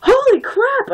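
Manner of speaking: high, very loud shriek; startled full-voice scream. A narrator's reading. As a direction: scream it out in shock. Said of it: "I screamed".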